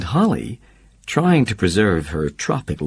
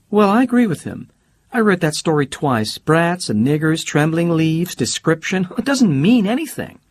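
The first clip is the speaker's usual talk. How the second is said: much higher, breezy socialite voice; Holly's bright voice; 10 semitones higher, 3 dB louder than usual. Acted. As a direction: play Holly teasing and carefree.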